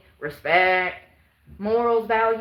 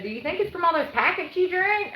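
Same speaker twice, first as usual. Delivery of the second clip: higher mock voice of the commenter; mocking the dude; 8 semitones higher than usual. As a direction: mimic him a bit nosy. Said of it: "This dude said".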